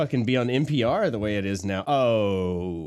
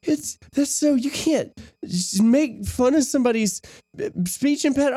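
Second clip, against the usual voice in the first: high mocking girl voice; 3 dB louder than usual. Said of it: "and she was like".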